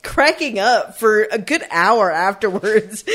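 ordinary talk

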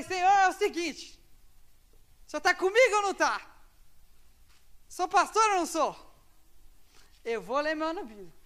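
high, loud challenge to God; desperate shouted prayer. Retold or imitated. Student shouting his ultimatum to God in prayer. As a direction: cry out demanding an answer.

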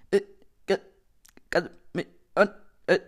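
loud broken-off fragments with gaps; superschlechtes Netz. Acted. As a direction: cut the words up like a dropping call. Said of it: "Der mit super schlechtem Netz". (abgehackt wie bei schlechtem Empfang)

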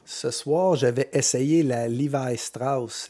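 usual speaking voice

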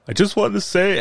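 high-pitched mock choked-up voice; imitating tearful speech. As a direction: imitate someone starting to talk through tears. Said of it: "Oh, he's crying".

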